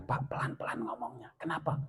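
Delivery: urgent whisper; hushing whisper. Retold or imitated. Wife whispering to her husband to calm down. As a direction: hush him before the daughter hears. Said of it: "Si ibu sampaikan".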